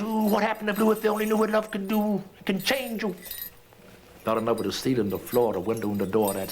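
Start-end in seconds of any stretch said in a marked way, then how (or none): 0.0-3.1 high, quick amazed gossip; amazed chatter. Retold or imitated. Schoolmates marvelling at how love changed Blue, high and quick. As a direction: rush it out in wonder.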